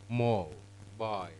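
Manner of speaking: "MO... bile", syllables apart, first one weightier; first-syllable stress. Shown as a demonstration. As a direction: break it into MO, pause, bile, dropping on the second. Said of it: "is on the first syllable".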